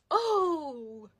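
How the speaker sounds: soft, drawn-out falling "oh"; sinking realization. Retold or imitated. Girl learns it's Emma, sinking realization. Reacting to crushing news.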